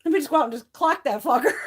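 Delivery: loud, high mock threat; wound-up comic outburst; retold anger. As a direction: relive the irritation, laughing at it.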